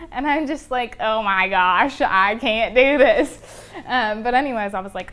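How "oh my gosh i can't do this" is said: slow, loud, drawn-out lament; overwhelmed despair. Retold or imitated. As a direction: let it sink in that it's hopeless. Too little paint for the floor.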